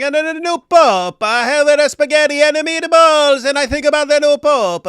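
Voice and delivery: loud, high-pitched cartoon Italian accent; mock Italian accent. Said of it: "saying all this in an Italian accent".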